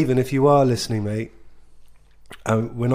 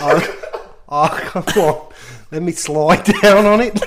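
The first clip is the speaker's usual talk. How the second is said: loud, giggly mock-Australian girl; bad Australian accent; 5 semitones higher, 8 dB louder than usual. Imitating the Australian girl from the party, giggly Australian accent. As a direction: attempt an Australian accent, cracking up laughing. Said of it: "can't do the accent very well".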